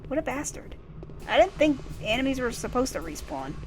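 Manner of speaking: usual speaking voice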